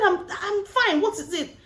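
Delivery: high-pitched acted irritation; imitating a partner who won't talk; mock huffy refusal. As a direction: insist it high, shutting down the questions. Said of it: "when we don't want to talk".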